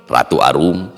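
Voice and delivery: ordinary delivery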